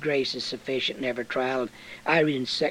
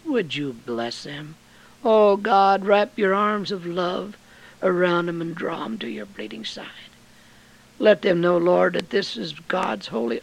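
higher, louder pleading prayer; fervent pulpit prayer; 7 semitones higher, 6 dB louder than usual. Acted. Preacher praying for a struggling brother, fervent. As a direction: pray over them with fervour. Preacher prays over listeners.

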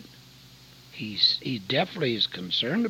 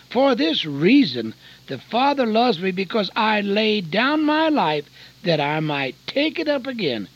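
louder, higher preaching voice; raised preaching over scripture; fervent preaching; 8 semitones higher, 7 dB louder than usual. Acted. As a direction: lift the scripture up to the hall. Preaching at full force.

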